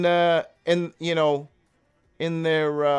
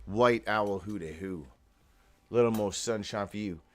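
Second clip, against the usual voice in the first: lightly held DJ announcer; 8 semitones lower, 3 dB quieter than usual. Acted. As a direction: call out the guests like a late-night DJ. DJ-style shoutouts over music.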